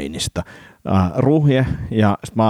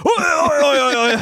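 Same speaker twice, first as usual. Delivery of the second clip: high squealing oi oi; mock horrified wail as the model breaks; imitating Harry's dismay; slower than usual. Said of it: "Sitten se silleen". (harrin kauhistunut kiljahdus)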